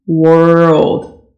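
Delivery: ordinary talk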